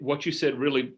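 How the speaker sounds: normal talking voice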